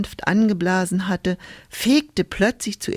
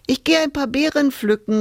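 calling out; 8 semitones higher than usual. A narrator's reading. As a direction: call out to mother from afar. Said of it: "rief sie der Mutter zu".

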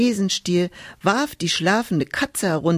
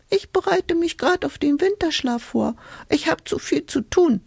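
much higher, squeaky and busy; hedgehog voice; 10 semitones higher than usual. Acted. Hedgehog preparing for winter sleep, high squeaky brush-off. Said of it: "sagte da der Igel".

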